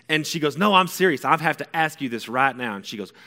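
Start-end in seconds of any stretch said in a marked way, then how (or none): 0.6-2.8 loud, raised, pressing; imitating an earnest mom who won't budge; insistent mom. Mom insists on asking son now, insistent.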